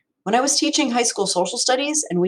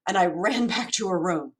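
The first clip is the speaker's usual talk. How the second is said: usual speaking voice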